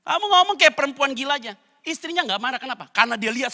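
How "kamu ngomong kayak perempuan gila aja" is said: high, loud, laughing retort; Job's rebuke, said with a laugh. Acted. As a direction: laugh while calling her crazy. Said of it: "kata dia".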